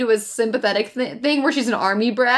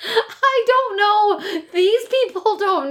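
laughing helplessly; 8 semitones higher, much slower than usual. Real, not acted. Laughing-crying giggle.

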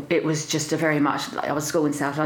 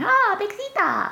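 mock fond call; slower than usual. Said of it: "started using it as a nickname and going".

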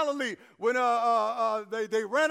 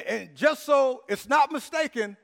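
ordinary delivery

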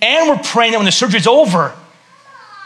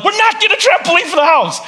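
high, loud flat refusal; emphatic dad saying no; firm refusal; 12 semitones higher, 4 dB louder than usual. Retold or imitated. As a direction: shut the idea down hard. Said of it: "I'm like".

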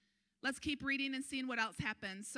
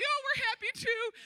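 much higher and louder, gushing; high, loud, giddy reply; elated crowd; 13 semitones higher, 8 dB louder than usual. Retold or imitated. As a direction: answer the leaders in giddy joy. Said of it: "They're crying".

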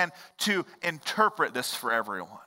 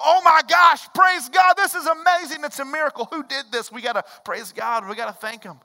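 high, loud, bursting with delight; mock joyful praise; 7 semitones higher, 5 dB louder than usual. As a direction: play the amazed believer who just saw a miracle. Imagined joyful reaction to miracle.

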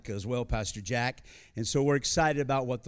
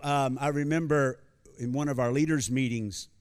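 normal talking voice

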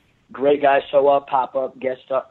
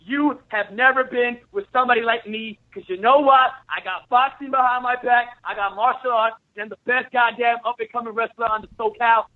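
tough-guy trash talk, pitched higher; wrestling promo; 3 semitones higher than usual. Acted. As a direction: cut a fierce heel promo. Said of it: "cut a promo for one minute".

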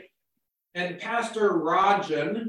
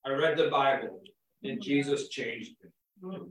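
low gruff man of few words; 5 semitones lower, 5 dB quieter than usual. Retold or imitated. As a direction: grumble it out, curt and low. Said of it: "used this gruff voice".

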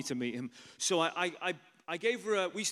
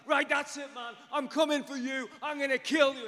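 high, loud raging shout; wild furious threats; 9 semitones higher, 6 dB louder than usual. Retold or imitated. Husband coming for the car's passengers, wild furious shout. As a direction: yell it like a man going nuts. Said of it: "going like a wild animal".

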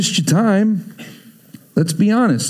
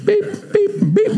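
high-pitched electronic beeps; imitated scanner beep. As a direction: mimic a card reader beeping. Imitating repeated card scans.

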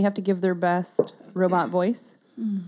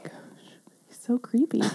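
usual speaking voice

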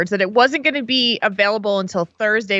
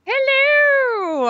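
excited. Real, not acted. Cheerful greeting to host.

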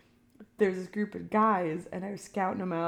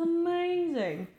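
slow mock awe; 9 semitones higher, slower than usual.

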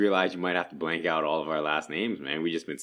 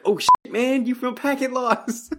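high, slow, awed fan spotting a celebrity; excited fan voice; 4 dB louder, slower than usual. Retold or imitated. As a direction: gasp it out like a starstruck fan. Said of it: "they'll be like".

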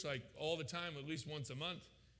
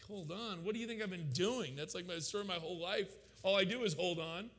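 higher, incredulous comeback; indignant retort; 4 semitones higher than usual. Retold or imitated. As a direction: throw the words back at God in protest. Indignant retort to God's answer.

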